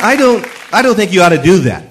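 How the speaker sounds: usual speaking voice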